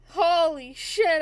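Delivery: ordinary talk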